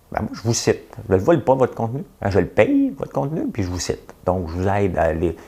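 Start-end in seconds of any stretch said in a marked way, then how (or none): none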